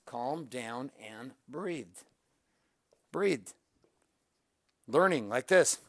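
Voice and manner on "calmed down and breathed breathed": soft and settled, letting the breath go; calmed down. Shown as a demonstration. Coach showing viewers how he calmed down, softly. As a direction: sink into calm and say it softly.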